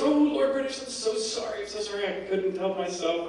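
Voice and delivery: high, whiny-sorry imitation of a culprit; mock pleading. Caught thief apologises to Lord British, high mock pleading. Thief begs forgiveness in story.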